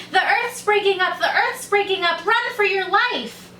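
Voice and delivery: high-pitched panicky shout. A narrator's reading. Little rabbit warns another rabbit, shouting out. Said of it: "the little rabbit just shouted out".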